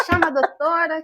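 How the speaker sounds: loud, high call for the doctor; calling out urgently. Retold or imitated. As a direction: shout for the doctor to come.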